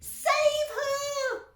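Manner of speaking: very high, loud cry; mother crying out to Wonka; cried. A narrator's reading. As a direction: cry out desperately. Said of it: "cried Missus Beauregard".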